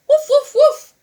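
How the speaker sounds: high, loud little dog barks; little dog barking in the lobby. A narrator's reading. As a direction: bark like a small excited dog. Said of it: "barked the little dog".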